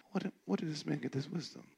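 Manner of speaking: quiet flat version of the question; hushed plain take; soft plain reading. Shown as a demonstration. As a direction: read it low and plain like scripture. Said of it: "do you think they said it like this?".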